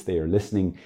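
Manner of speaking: usual speaking voice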